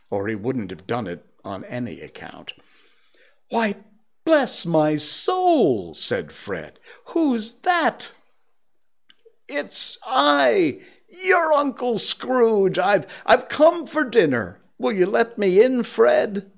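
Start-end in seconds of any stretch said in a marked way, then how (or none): 3.5-5.9 high, drawn-out cry of surprise; startled exclamation. Acted. Fred finds a visitor at his door, startled. 7.1-8.1 high-pitched, surprised call; startled question. Acted. Fred calls to whoever is there, startled. 9.5-16.5 high, loud, eager announcement; elated greeting. Acted. Scrooge asks his nephew to let him in, elated. Scrooge arrives joyfully for dinner.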